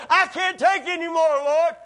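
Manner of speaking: high, loud despairing wail; loud mock anguish to the Lord; anguished cry. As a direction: cry out like a beaten man. Voicing Paul's despair at whipping post.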